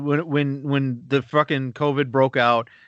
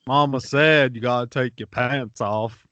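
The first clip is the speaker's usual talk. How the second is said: slow, simple-minded movie character impression; Forrest Gump; 2 semitones lower than usual. Comic riffing to a co-host, Gump voice. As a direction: draw it out as a mock Gump. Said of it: "doing the Gump voice".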